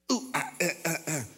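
high fussy chirping whimpers; whiny baby bird noises. Pretend. Baby bird whimpering as its mother pulls the comfort away. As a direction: squeak out a baby bird's protest.

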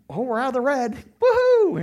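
high whoop of relief; mock celebration. Pastor cheering the church being out of the red, mock celebration. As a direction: cheer it up into a loud, high woohoo.

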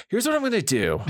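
high, bold announcer line; dramatic hero voice. Pretend. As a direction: declare it like a movie hero. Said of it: "cut out all my bullshit".

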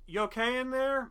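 high, sing-song check-in; asking a coworker in the stall if she's okay; polite concern. Retold or imitated. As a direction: call through the stall door politely. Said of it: "I'm like".

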